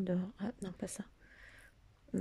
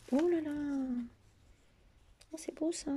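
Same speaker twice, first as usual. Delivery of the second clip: high delighted exclamation; 5 semitones higher, faster than usual. Real, not acted. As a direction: gush over the gift. Delighted by a gift item.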